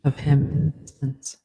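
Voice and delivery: slow dictation, punctuation spoken aloud; dictating. Shown as a demonstration. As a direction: dictate it word by word with pauses.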